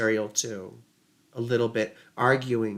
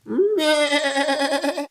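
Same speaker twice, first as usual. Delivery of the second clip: high bleating meh; goat bleat; 11 semitones higher than usual. Retold or imitated. Mimicking goats for the listener, bleating meh. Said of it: "My goats".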